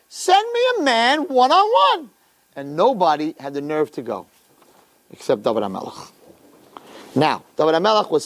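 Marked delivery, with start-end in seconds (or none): 0.1-2.1 challenging. Retold or imitated. Goliath daring the army to send a fighter, challenging. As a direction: call out a slow, high challenge.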